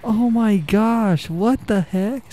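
high, slow astonishment; amazed. Real, not acted. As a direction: drag the words out high in wonder. Amazed by the attack scene.

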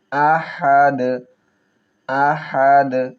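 আহাদ with the h from mid-throat; throat h in ahad. Shown as a demonstration. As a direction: breathe আহাদ out from the middle of the throat. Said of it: "হলকের মধ্যখান থেকে উচ্চারণ করব".

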